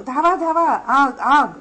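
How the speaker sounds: loud, high cry for help; shouting for help. A narrator's reading. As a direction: yell out in panic as the fire spreads. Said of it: "तो मदतीसाठी ओरडू लागतो". (मदतीसाठी घाबरून जोरात ओरडणे)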